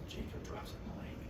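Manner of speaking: whispered, very soft narration; hushed reveal. Retold or imitated. Narrator revealing rival's miss, hushed reveal. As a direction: whisper the bad news.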